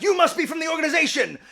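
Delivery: high, loud, rapid accusation; paranoid mad scientist. Retold or imitated. Okabe accuses the genius girl of spying, hammed-up paranoid outburst.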